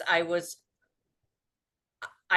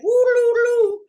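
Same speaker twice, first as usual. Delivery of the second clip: high, loud siren-like whoop; alarm wail; 9 dB louder, slower than usual. Retold or imitated. Imitating a panicked amygdala's alarm, high loud wail.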